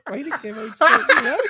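high, laughing repeat of his own slip; giggling at the accidental innuendo. Real, not acted. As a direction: laugh through the words.

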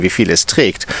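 plain reading voice

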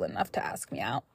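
fast unvoiced whisper. Real, not acted. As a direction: whisper it as an aside. Whispered aside.

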